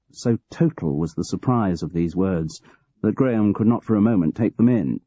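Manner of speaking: plain reading voice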